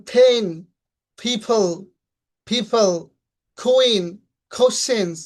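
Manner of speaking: পেন, পিপল as "phen, phiphol"; কুইন, কোশ্চেন্স with a puffed "kh"; word drill, strong air on first consonant of পেন and কুইন; aspirated P and K. Shown as a demonstration. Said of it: "এরকম ভাবে উচ্চারণ করব".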